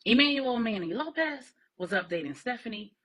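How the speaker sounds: plain reading voice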